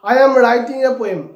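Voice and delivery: each word spaced out slowly for copying; slow dictation. Shown as a demonstration. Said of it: "number eight".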